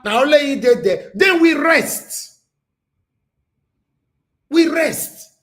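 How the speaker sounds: loud, high, heated rant; rising into an angry shout at critics. Real, not acted. Heated rant about coven critics.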